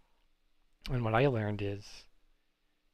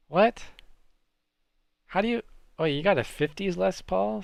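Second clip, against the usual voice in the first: high, loud, stunned "what?"; can't believe what the viewer just said; astonished; 8 semitones higher, 7 dB louder than usual. Real, not acted. Streamer reacting to a viewer's fifties Les Paul, loud and high in disbelief.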